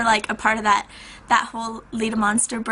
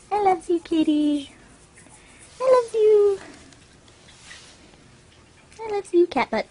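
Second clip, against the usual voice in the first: high-pitched cooing to a cat; 10 semitones higher than usual. Real, not acted. Owner calling and cuddling the cat, baby talk. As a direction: coo way up high, doting.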